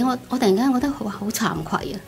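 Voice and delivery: ordinary talk, nothing marked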